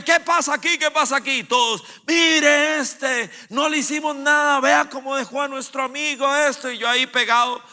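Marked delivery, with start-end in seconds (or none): 0.0-1.4 loud, much higher officer demand; police officer. Retold or imitated. Police arriving at the fight. 2.1-6.7 higher, louder protest; the gang accusing him to the police, shouting. Retold or imitated. Crowd accusing the speaker.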